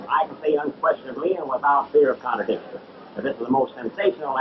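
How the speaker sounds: showman hawking a free show; carnival barker. Acted. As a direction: pitch the show like a sideshow spieler. Showman spiel to the crowd.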